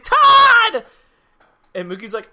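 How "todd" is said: high, loud shout across the bar; shouting a name. Retold or imitated. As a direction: call out happily to a friend. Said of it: "She's like".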